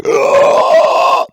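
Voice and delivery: high, loud drawn-out "ugh"; sucked-up groan. Acted. Carpet showing how vacuuming feels, high groan. Imitates vacuum sucking air.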